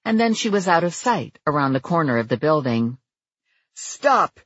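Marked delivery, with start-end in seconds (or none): 3.7-4.4 high, urgent command to halt; shouted. A narrator's reading. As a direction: bark a high stop at the fleeing man. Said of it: "she shouted".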